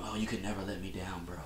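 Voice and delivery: mock a soft, pleading star athlete, barely voiced; hushed mock-sentimental quote; soft-voiced LeBron James. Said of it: "Why did your voice go up an octave".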